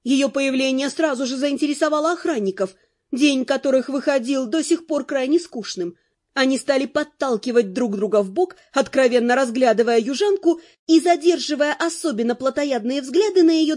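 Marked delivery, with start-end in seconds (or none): none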